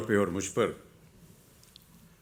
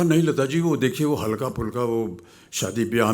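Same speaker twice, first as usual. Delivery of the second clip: retold protest; 3 semitones higher than usual. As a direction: brush off the suggestion, pitched up. Retells his protest to singer.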